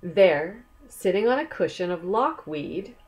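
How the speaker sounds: usual speaking voice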